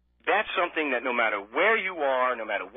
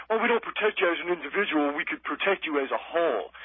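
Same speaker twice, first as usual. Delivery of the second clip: mocking government voice; 3 semitones higher than usual. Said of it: "they come out and say".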